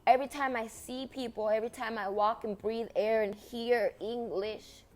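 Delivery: ordinary talk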